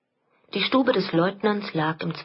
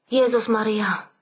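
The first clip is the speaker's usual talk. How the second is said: slightly higher-pitched cry of alarm; a touch of startled exclamation; exclaiming woman; 4 semitones higher, 3 dB louder than usual. A narrator's reading. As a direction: call it out, mildly startled. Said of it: "rief die schöne Spanierin".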